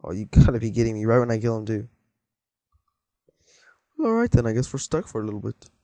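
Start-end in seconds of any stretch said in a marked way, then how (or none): none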